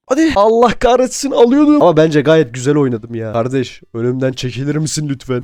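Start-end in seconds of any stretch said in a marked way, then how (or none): 0.1-1.8 higher, louder cursing at losing; frustrated outburst. Real, not acted. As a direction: yell it as the win slips away. Just lost the round.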